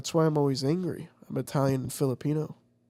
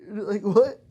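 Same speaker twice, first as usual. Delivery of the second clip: higher and louder, hammed-up indignation; mock tough guy; 7 semitones higher, 7 dB louder than usual. Telling friend about the bar guy, loud mock outrage. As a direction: act like someone disturbed you for nothing. Acting out his confrontation.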